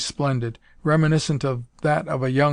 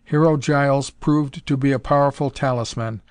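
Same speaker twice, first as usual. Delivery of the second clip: ordinary delivery, nothing marked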